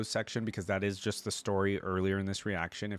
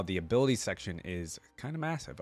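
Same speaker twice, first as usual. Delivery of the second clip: normal talking voice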